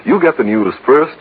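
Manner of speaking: usual speaking voice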